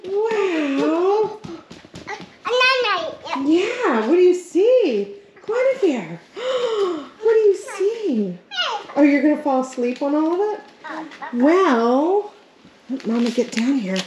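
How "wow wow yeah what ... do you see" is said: high slow cooing wonder. Real, not acted. Mother coos at baby.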